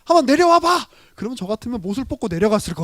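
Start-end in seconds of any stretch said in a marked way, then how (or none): 0.0-0.9 much higher and louder taunting call; loud mocking heckle from below; mocking jeer. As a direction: jeer up at the cross like a scoffer in the crowd. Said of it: "저 같으면 막 밑에서 조롱하잖아요".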